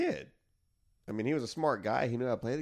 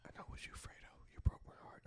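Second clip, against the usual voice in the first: whisper; 15 dB quieter, faster than usual. Retold or imitated. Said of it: "quoting Godfather line".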